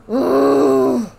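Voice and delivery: a sudden loud ooh of alarm; loud startled ooh. Real, not acted. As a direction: jump and let out a loud ooh. Startled by something in game.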